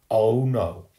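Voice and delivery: loud groaned-out protest; loud dismay. A narrator's reading. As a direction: cry out in dismay at what you see. Boy dismayed at the cover.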